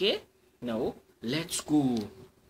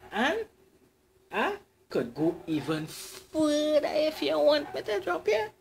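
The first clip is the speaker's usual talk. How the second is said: eager suitor; 11 semitones higher than usual. Acted. Eager cyclist offers more.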